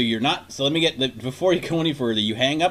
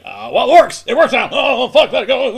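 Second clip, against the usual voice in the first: loud, high, laughing give-up; mock frantic laughing cry; laughing panic; 8 semitones higher, 9 dB louder than usual. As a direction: blurt it out and drop the call. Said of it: "you're like".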